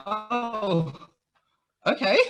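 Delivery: high, drawn-out oh okay; stunned surprise. Retold or imitated. Told she made history, high surprised oh okay. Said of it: "I was like".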